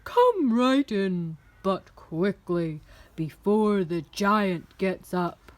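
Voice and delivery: loud, slow, huge voice; booming giantess. A narrator's reading. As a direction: boom out slow sympathy. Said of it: "boomed the giantess".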